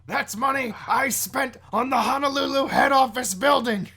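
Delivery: high, loud, blustering impression; Vince McMahon. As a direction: belt it out as an outraged boss. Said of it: "how to do Vince McMahon".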